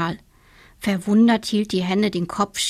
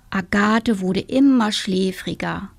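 ordinary delivery

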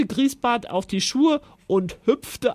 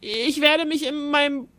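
sobbing; 6 semitones higher than usual. A narrator's reading. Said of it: "schluchzte Griesbart".

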